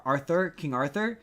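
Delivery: normal talking voice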